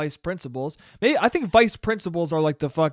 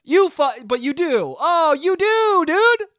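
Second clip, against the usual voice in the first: higher and louder, building to a shriek; rising yell; 9 semitones higher, 5 dB louder, slower than usual. Acted. Comedian yelling at the creepy vice principal, building higher and louder. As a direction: climb up into a shrieking accusation. Comic escalating accusation.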